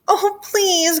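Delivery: high, drawn-out begging; whiny high-pitched plea; wailing plea. Acted. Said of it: "Gertie wailed".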